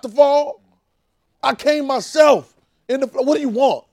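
high, loud, indignant demand; Jesus losing patience with his people; exasperated Jesus shouting. Retold or imitated. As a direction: shout it like a wronged father. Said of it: "I see Jesus talking to me that way".

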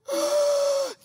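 a sudden high gasp of relief; startled intake of breath; sharp gasp. Retold or imitated. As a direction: gasp like you just caught the plane. Relief at the airport gate.

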